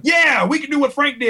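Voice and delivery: high-pitched, gung-ho retort; retold burst of overeager confidence; eager insistence. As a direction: cheerlead your idea to a doubter. Retells pitching idea to doubtful artist.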